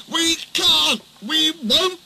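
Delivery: high, strained whining refusal; stubborn trucks. A narrator's reading. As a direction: whine it like old trucks that refuse to budge.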